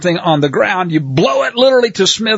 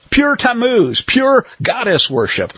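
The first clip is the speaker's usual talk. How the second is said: high-pitched sarcastic welcome, climbing as it goes; scornful, rising to a high mocking finish; mock enthusiasm; 6 semitones higher than usual. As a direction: sarcastically wave it all in, voice climbing. Mocks church welcoming pagan worship.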